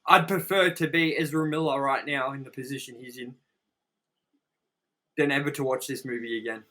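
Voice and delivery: usual speaking voice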